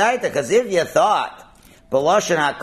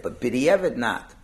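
ordinary talk, nothing marked

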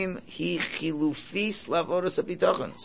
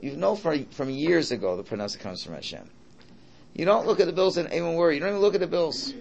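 usual speaking voice

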